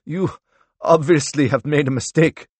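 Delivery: accused man pushing back; indignant denial. A narrator's reading. Accused man rejects the charge.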